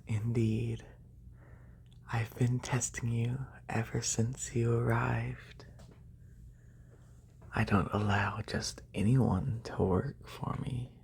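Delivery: usual speaking voice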